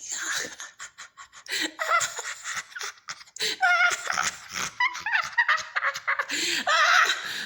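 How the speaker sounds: high, shrieking demonic cackle; hysterical laugh. Retold or imitated. Demon laughing from the darkness below, a hysterical high cackle. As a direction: cackle like something evil finds it hilarious. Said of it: "I can hear laughing, like, hysterically".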